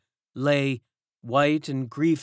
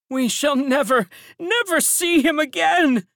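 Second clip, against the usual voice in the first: high, loud lamenting woman's voice; grieving elderly servant; 7 dB louder than usual. A narrator's reading. Servant in the courtyard laments the stolen child, high and loud. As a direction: cry it out as an old servant in mourning. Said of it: "said an elderly woman, wringing her hands".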